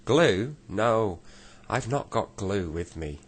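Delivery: deaf saucepan man. Acted.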